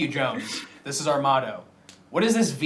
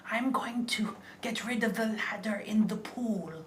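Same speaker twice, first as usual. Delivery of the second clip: [name] voice; 7 semitones higher, 8 dB quieter, slower than usual. Pretend. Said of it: "I would love to be [name] and play The Sims".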